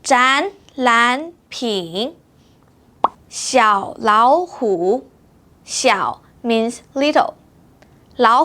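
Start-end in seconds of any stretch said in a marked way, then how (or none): 0.0-2.2 展览品 very slow, 展 and 览 rising, 品 dipping; tone sandhi. Shown as a demonstration. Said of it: "So this word should be spoken as". 3.3-5.1 小老虎 said very slowly; slow, clear tones on each syllable; slow tone demo. Shown as a demonstration. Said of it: "would be spoken as".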